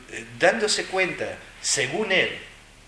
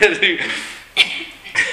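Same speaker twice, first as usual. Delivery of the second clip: bursts out laughing high; a real high giggle at himself; laughing at his own joke; 7 semitones higher, 8 dB louder than usual. Real, not acted.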